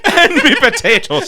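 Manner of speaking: mock Irish accent. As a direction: ham up a stage Irishman. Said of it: "most racist. Stereotypical".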